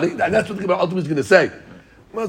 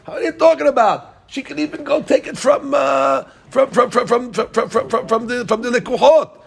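higher-pitched, worked-up challenge as Rabbi Hanina; incredulous challenge; 6 semitones higher than usual. Retold or imitated. Teacher voicing Rabbi Hanina challenging Rabbi Yohanan, higher and heated. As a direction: argue it with rising heat, tripping on the words.

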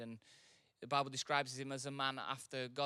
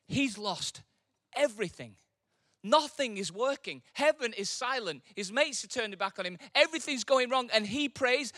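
louder, higher, building sermon; rising preaching; 7 semitones higher, 7 dB louder than usual. Acted. Preacher builds the congregation toward Job's prayer. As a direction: build the intensity toward the verse.

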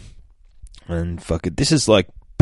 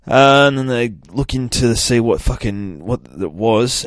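ordinary talk, nothing marked

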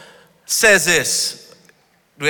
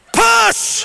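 shouted push. Shown as a demonstration. Calling the scrum for volunteers on stage, full shout. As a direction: yell it like a referee. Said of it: "touch, engage".

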